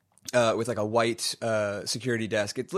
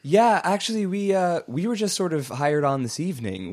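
receptionist voice; 7 semitones higher, 3 dB louder than usual. Acted.

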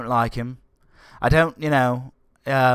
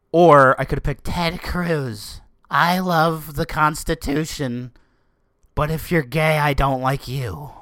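slow, higher mock voice; mocking Ted Cruz; 5 semitones higher, 4 dB louder, slower than usual. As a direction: parody a preachy politician. Mocking Ted Cruz's views.

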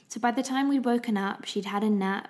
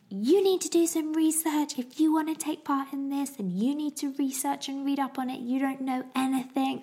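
exasperated scolding; 7 semitones higher than usual. Retold or imitated. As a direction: scold him like he should know better. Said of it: "Oh my gosh, it was bad".

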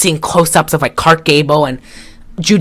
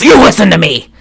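raised, higher bossy order; mock stern command; 4 semitones higher, 4 dB louder than usual. Myra ordering Rusty on the scale, mock stern command. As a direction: bark it like someone on a power trip.

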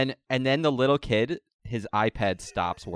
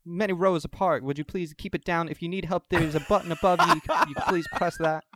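higher, prim attendant scolding politely; flight attendant; 7 semitones higher than usual. Acted. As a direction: shush the rowdy passengers primly. Attendant scolding rowdy passengers.

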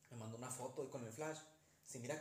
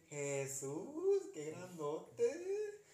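drawn-out, higher mock-spooky wail with a laugh; podcast ghost line; 4 semitones higher, 6 dB louder, much slower than usual. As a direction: stretch it like a hammed-up scary narrator. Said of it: "Así lo pone el podcast".

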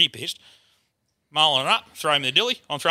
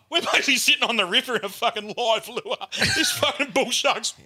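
higher voice breaking into laughter; laughing while telling; 6 semitones higher than usual. Real, not acted. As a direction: tell it through real, incredulous laughter. Laughs telling the absurd danger.

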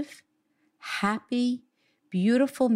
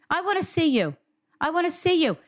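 lightly voiced pleading mother; a hint of a begging mother; imitating mom; 9 semitones higher than usual. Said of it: "that's what my mom says".

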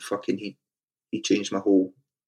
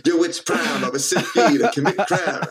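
high-pitched, hammed-up American rap intro; mock rhyming brag in American accent; American rapper accent; 6 semitones higher, 4 dB louder than usual. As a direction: parody your old American rapper persona. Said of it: "That kind of stuff".

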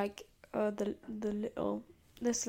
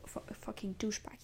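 hushed aside under the breath; a sneaky whispered jab; whispered insult; 6 dB quieter, faster than usual. Real, not acted. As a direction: whisper the swear so no one hears.

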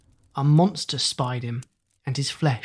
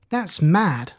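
usual speaking voice

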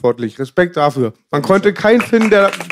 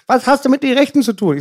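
higher-pitched mother's voice on the phone; imitating his mother; 9 semitones higher than usual. Son retelling his mother's phone call, in a higher mother voice. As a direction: play the mom calling, pitched up high. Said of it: "rief mich meine Mutter an".

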